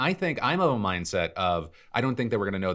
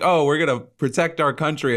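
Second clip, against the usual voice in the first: mock official; 9 semitones higher, 7 dB louder than usual. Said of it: "could have been like".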